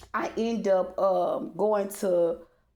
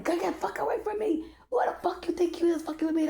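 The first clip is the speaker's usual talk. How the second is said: high, hissed retort rising from a whisper; mock telling off; 9 semitones higher, faster than usual. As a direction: snap back at the stranger. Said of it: "I'm talking shit to the guy".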